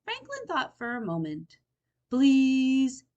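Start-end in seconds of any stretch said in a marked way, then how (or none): 2.1-3.0 louder pleading please; begging. A narrator's reading. Fox begging Franklin to tell the secret. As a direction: beg for the secret. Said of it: "begged Fox".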